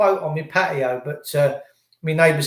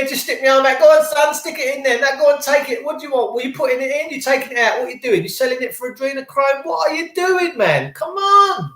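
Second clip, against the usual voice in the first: higher-pitched sarcastic rant, climbing to a plea; mock exasperation; 5 semitones higher than usual. Host mock-submits to forced testing, then protests in exasperation. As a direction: protest in a raised, incredulous voice.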